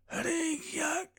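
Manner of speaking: dying man rasping his friend's name; hoarse whisper. A narrator's reading. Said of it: "flüsterte er heiser". (heiseres Flüstern)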